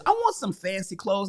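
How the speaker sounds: normal talking voice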